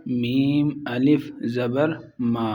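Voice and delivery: teacher sounding out each part slowly; patient, slow phonics demo; slow spelling drill. Shown as a demonstration. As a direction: spell out میم الف زبر slowly, letter by letter, then join into ما. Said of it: "یہاں پر کیا ہو گیا؟".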